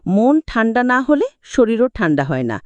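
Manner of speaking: plain reading voice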